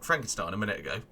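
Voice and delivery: ordinary talk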